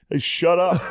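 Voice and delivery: high, irritated call-out; imitated annoyed holler; mock heckler. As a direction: yell it at the stage like an annoyed diner. Diner customer heckling the comic.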